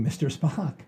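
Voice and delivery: ordinary delivery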